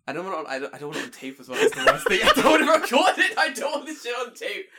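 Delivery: loud, high laughing refusal; embarrassed laughing outburst; laughing protest. Real, not acted. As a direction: protest helplessly through laughter. Laughing protest at recording own accent.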